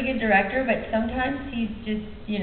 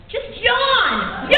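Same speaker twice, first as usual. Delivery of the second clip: imitated impatient high shout; retold yelling; 11 semitones higher, 5 dB louder, much slower than usual. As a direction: yell it like an exasperated director. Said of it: "when you're yelling at me".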